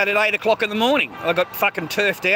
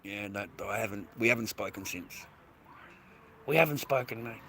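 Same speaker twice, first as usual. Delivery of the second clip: much softer, lower, sinking away; quiet, withdrawn; 8 semitones lower, 15 dB quieter than usual. Real, not acted. Uncle admitting he no longer speaks to family, quiet. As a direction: let the words drop off into near silence.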